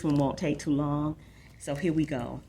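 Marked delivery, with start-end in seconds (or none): none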